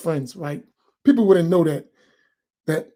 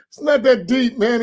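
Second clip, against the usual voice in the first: ordinary talk, nothing marked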